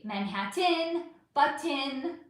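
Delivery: Manhattan and Button slow with crisp T; enunciated clearly. Shown as a demonstration. Host proudly showing chat her diction. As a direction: Manhattan and Button spelled out by mouth. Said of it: "I know how to enunciate clearly".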